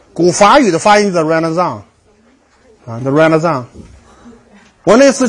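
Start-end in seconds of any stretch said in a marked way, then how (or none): 0.1-3.6 Renaissance said ruh-neh-SAHNS, nasal ending; French-style Renaissance, stress at end; French pronunciation. Shown as a demonstration. Lecturer shows the Old French way to say Renaissance.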